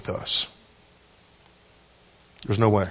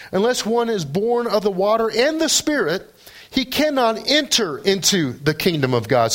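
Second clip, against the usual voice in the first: full preaching; 9 semitones higher than usual. Acted. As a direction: preach it with rising fervor. Preaching Jesus' words to Nicodemus.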